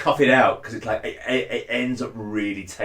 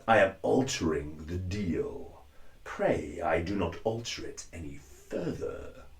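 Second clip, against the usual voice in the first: low, quiet menacing Vader; deep hushed threat; 4 semitones lower, 8 dB quieter than usual. Shown as a demonstration. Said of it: "other phrases that I have written out".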